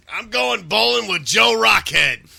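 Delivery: Fred announcing bowling night; Fred Flintstone quote. Retold or imitated. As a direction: boom it like Fred. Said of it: "quoting Fred".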